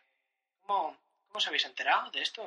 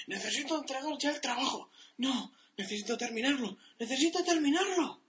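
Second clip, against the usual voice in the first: rising from high to very high, more and more frantic; panicked; 10 semitones higher than usual. Acted. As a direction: spiral into deadline panic. Panicked worker sketch.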